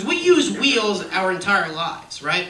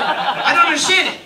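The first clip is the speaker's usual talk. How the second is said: mock outrage; 8 semitones higher, 7 dB louder than usual.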